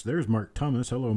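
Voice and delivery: ordinary talk, nothing marked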